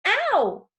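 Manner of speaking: high, loud cry of pain; pained yell. Acted. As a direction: howl in pain. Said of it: "he just kept yelling".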